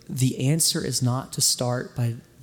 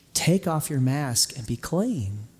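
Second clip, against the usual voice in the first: usual speaking voice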